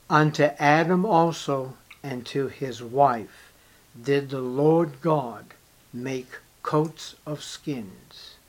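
ordinary talk, nothing marked

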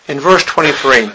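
ordinary delivery

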